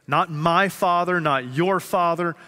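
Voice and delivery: "my" and "your" stressed high and loud, slow; contrastive stress. Shown as a demonstration.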